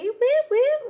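high, loud whooping; excited cheer. Real, not acted. Host cheering viewers on the live chat, whooping.

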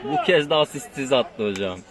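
excited. Real, not acted. Co-commentator excited after goal.

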